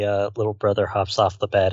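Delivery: usual speaking voice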